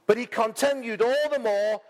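high, slow, rising preaching voice; dramatic scripture reading. Acted. Preacher reads Mark ten to the congregation with rising fervour. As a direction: read it out like a fervent preacher.

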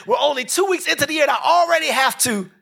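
squeaky fed-up mock complaint; frustrated high pitch. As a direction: peak of the rant, voice up high. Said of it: "and you like".

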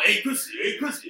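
mock holler for mom; imitated calling out. Stepdad calling the teen's mother, imitated urgent holler.

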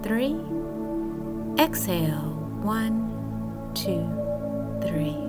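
soft, hushed counting; guided breathing count. Acted.

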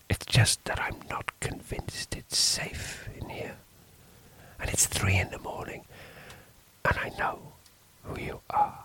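nervous priest in a whisper. A narrator's reading. Priest whispering fearfully to the stranger in the dark. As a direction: keep it hushed and uneasy.

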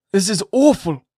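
put-on an old magistrate crying out in dismay; cried out. A narrator's reading. Governor reacting to Pearl's answer, shocked cry. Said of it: "cried the governor".

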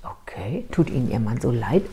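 very soft, low, nearly whispered at first; hushed careful question. Retold or imitated. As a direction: ask it gently under your breath. Gentle question to crying client. (behutsam, leise und tief nachgefragt)

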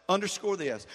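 normal talking voice

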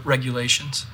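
ordinary talk, nothing marked

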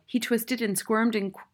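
usual speaking voice

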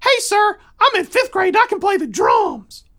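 put-on high, loud bragging kid; very high little-boy voice; young son's voice. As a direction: announce it like an eager fifth grader. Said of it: "the son turns around to me and says".

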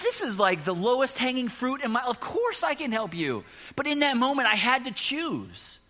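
almost shouting with excitement; overjoyed preacher telling the story; excited. Real, not acted. Excited retelling of the moment.

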